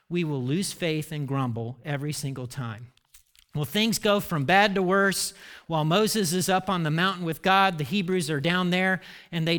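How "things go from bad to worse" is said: usual speaking voice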